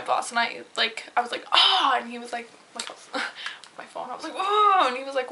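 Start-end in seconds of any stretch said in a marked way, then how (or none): 1.5-2.0 outraged ah. Retold or imitated. Reacting while telling her boss. 4.4-4.8 indignant oh. Retold or imitated. Reacting on the phone to boss.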